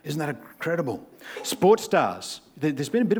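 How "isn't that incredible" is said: mock amazement. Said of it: "we go".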